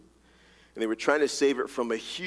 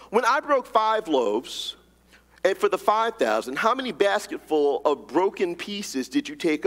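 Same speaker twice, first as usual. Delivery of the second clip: raised, earnest reading of the gospel passage; scripture reading; 6 semitones higher than usual. Acted. Pastor reading the passage aloud to the congregation, proclaiming. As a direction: read it out like proclaiming it to the church.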